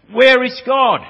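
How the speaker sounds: huge minister bellows the question high and loud; booming shout. Retold or imitated. Minister shouting at four-year-old boy, booming yell. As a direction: shout it to frighten a small boy. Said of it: "he raised his voice once again".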